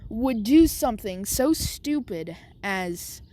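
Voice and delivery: usual speaking voice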